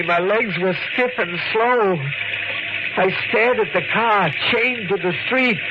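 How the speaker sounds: rising panic. A narrator's reading. Narrating car about to hit him.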